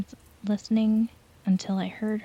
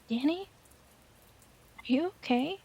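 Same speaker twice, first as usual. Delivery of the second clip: higher, softer, sleepy and groggy; just woken, drowsy concern; 7 semitones higher, 5 dB quieter than usual. Acted. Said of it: "Sarah said in a groggy voice".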